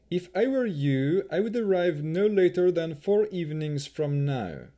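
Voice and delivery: 1 haughty elf chamberlain. A narrator's reading. Rahadin voiced in narration.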